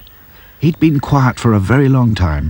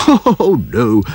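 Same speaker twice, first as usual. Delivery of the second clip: laughing; 4 semitones higher than usual. A narrator's reading. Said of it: "laughed the professor".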